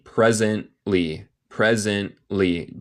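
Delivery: "Presently" slowly, T cut to a silent glottal stop; swallowed T. Shown as a demonstration. Teacher shows a glottal stop, "Presently" with the T swallowed. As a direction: say "Presently" with a gap where the T is. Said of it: "yo como el té".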